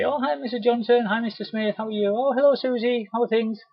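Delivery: high, bright hellos in a row; cheery greetings. Shown as a demonstration. Rep bumping into customers in town, cheery. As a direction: greet each customer like a friend.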